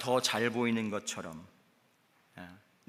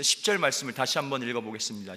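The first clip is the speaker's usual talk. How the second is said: usual speaking voice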